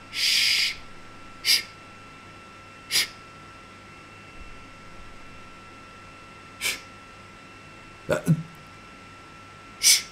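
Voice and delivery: long breathy shh sounds; drawn-out whispered shh; whispered shushing. Acted. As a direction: hush them like a librarian. Shushing the chat.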